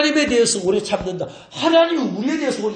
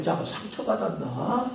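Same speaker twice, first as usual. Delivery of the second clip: worried muttering; 3 semitones lower, 8 dB quieter than usual. Retold or imitated. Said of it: "가슴이 벌렁벌렁".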